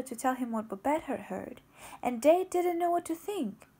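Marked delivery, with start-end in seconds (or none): none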